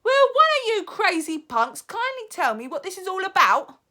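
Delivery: put-on high, loud boy's shout. Bruno shouting at the witch, high and loud. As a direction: shout the demand. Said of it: "shouted Bruno".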